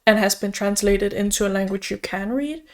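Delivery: ordinary delivery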